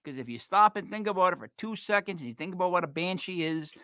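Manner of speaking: ordinary talk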